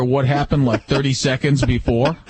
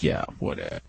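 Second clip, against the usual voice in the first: dismissive whisper; 10 dB quieter than usual. Retold or imitated. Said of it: "And I'm like".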